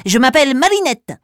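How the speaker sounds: high squeaky mouse introduction; little mouse voice. Acted. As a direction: chirp your name like a mouse.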